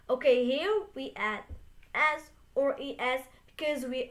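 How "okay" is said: ordinary delivery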